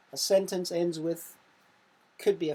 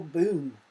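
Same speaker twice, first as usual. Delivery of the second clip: louder boom, said like an explosion; booming sound word; 5 dB louder than usual. Shown as a demonstration. Teacher voicing the last quiz option, a loud boom. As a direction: make it go off. Reading onomatopoeia options to pupils.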